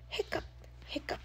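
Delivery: high whispered hiccup sounds; monster hiccups. A narrator's reading. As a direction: squeak out two breathy hiccups.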